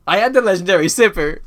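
loud, high, booming announcement; over-the-top dramatic declaration; mock-grand proclamation. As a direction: proclaim it like a big reveal. Announces himself, then take in Mandarin voice.

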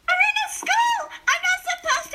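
a cartoon squeal of protest; high squeaky shout. Reader voicing the outraged kid, high squeaky shout.

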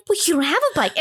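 high-pitched mock puzzlement; laying it on. Relatives in on the bike joke, hammed-up puzzlement. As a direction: play innocent, pitched well up. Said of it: "They're really laying it on".

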